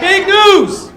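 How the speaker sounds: high, loud big reveal; excited announcement. Real, not acted. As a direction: tease the news with a laugh.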